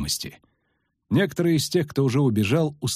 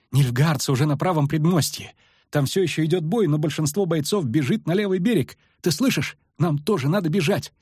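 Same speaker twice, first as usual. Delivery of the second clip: high, agitated report; urgent alarm; 7 semitones higher than usual. A narrator's reading. Lyutik warns Geralt the enemy is here, alarmed. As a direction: press them urgently to run. Lyutik urges flight from battle.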